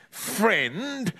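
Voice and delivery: snarled. Acted. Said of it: "snarled Mister Parker".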